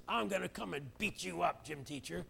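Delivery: a hint of a defiant kid taunting the teacher; unruly kid. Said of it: "the little kid says".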